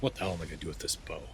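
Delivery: quick, quiet grumble to a comrade; grumbling orc. Acted. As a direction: mutter it fast under the breath. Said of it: "and he says".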